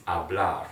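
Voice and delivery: "hablar" with a silent h. Shown as a demonstration. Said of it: "I didn't use the H".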